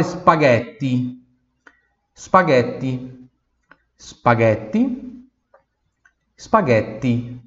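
spaghetti said slowly, pure hard T, no ch sound; pure Italian T. Shown as a demonstration. Teacher drilling spaghetti with a pure T, slowly. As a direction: stretch out spaghetti with a clean T. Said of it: "Pronúncia pura do T".

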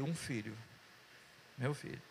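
low, softened reply; hushed low confession; subdued answer. Retold or imitated. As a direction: answer quietly and humbly, pitched low. Retells his answer to God.

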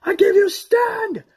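high, slow, crying heroic rally; epic battle speech. Retold or imitated. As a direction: shout to the army to stand. Acting out Aragorn's battle speech.

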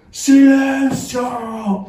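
drawn-out stern yell of silence; mock shout. Inspector silencing a rioting schoolyard, drawn-out yell. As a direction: stretch the command out long and high. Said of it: "grita así".

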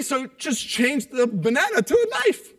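normal talking voice

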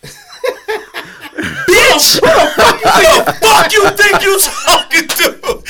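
very high, loud yell through laughter; hammed-up furious screech; outraged shout. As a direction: explode at being patronised. Retold outrage after patronising praise.

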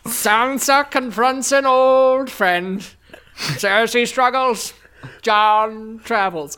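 very slow, loud statesman caricature; hammed-up, drawn-out speechifying; Winston Churchill. Shown as a demonstration. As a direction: stretch each word like a pompous wartime orator. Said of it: "I'll do Winston Churchill".

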